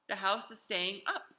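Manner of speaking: usual speaking voice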